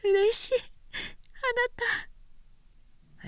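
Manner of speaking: put-on young woman thrilled to be proposed to; overjoyed young woman. A narrator's reading. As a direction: glow with joy.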